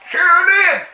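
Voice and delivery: high loud exclaim; emphatic confirmation of the slap. Acted. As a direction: bark it out, emphatic.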